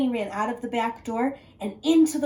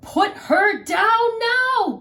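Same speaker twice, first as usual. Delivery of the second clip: high, loud, slow shout; bellow; 12 semitones higher, 8 dB louder, slower than usual. Acted. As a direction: woodcutter threatening the wolf. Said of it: "bellowed the woodcutter".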